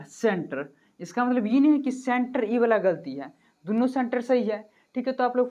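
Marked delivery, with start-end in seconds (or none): none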